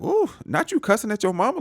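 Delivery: high-pitched playful telling-off; mock scandalized scolding. Teasing a son who cusses at his mama, scandalized. Narrator scolds character for cussing.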